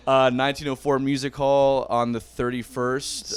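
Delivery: ordinary talk, nothing marked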